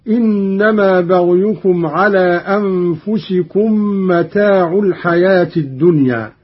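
متاع ends in u, not a; Qalun reading. Shown as a demonstration. Teacher demonstrating Qalun's damma on متاع, recited. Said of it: "قرأ قالون برفع العين هكذا".

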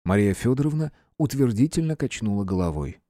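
ordinary delivery, nothing marked

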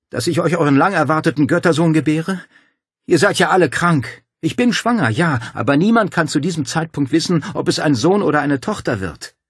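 loud, high, incredulous outburst; outraged protest. A narrator's reading. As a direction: throw their madness back at them. Cornered woman protests their plan.

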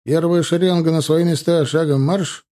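loud, high drill command. A narrator's reading. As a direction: bark the order to the rank. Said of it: "скомандовал прапорщик".

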